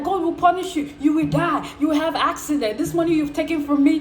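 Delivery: hammed-up indignant damnation; retold angry cursing. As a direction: hurl curses at the scammer, half in jest.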